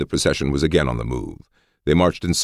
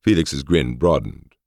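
ordinary delivery, nothing marked

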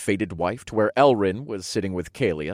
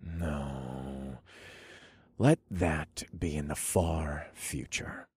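tender; 6 semitones lower, 9 dB quieter, much slower than usual. A narrator's reading. Tender reply to a vow of death.

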